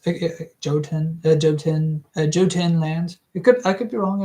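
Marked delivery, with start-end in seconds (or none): none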